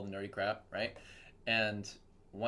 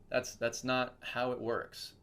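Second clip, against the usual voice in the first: normal talking voice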